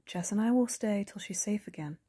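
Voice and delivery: whisper. Acted. Whispered line in tense scene.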